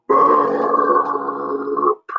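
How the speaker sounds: long low burp sound; monster belch. Acted. Monster after eating, long low belch. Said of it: "and then belched".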